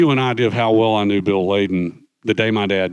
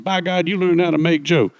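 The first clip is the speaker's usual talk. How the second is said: pleased Snafu; 8 semitones higher than usual. Retold or imitated. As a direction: crow it like a happy old grunt. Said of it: "Snafu was very happy".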